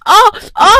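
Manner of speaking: short high-pitched yelps; cries of pain. Acted. Stepmother beaten by Rupali, high cries of pain. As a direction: yelp as the blows land.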